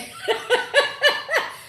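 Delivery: high burst of real laughter; laughing. Real, not acted. Host cracking up at a viewer's teasing comment, laughing. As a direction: crack up in a long giggle.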